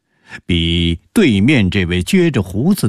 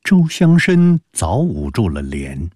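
ordinary delivery, nothing marked

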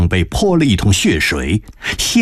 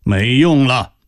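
cold; 5 semitones higher, slower than usual. Acted. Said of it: "冷冷地说着".

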